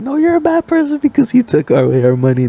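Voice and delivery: high, loud imitated scolding; arguing. Grandma arguing with the driver about the money, high and loud. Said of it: "grandma's just arguing".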